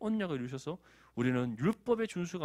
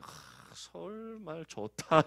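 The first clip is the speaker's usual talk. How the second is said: 아주 느리게 늘어지는 감탄; 서울 말에 반한 듯 천천히 감탄하기; 느릿하게 감탄하는 자매; 4 semitones higher, much slower than usual. Retold or imitated. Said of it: "자매들 뭐라 해요?". (서울 말투에 반한 자매 흉내, 아주 느린 감탄)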